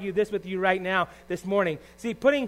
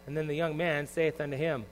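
ordinary talk, nothing marked

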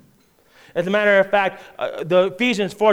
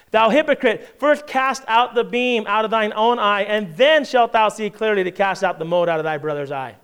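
forceful scripture reading; 5 semitones higher, 4 dB louder than usual. Acted. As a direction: proclaim the verses with full force. Reads scripture at full force.

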